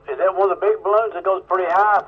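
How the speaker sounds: ordinary delivery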